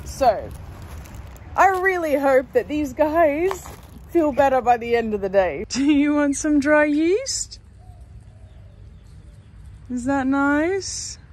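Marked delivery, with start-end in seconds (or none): 5.7-11.2 coaxing ducklings. Real, not acted. Duck mum offering yeast to ducklings, coaxing. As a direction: gently tempt them to eat.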